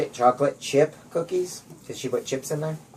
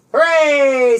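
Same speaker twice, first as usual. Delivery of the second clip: high, loud hooray; excited whoop; cheer; 11 semitones higher, 5 dB louder than usual. A narrator's reading. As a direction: cheer the pitched tent. Cheering success.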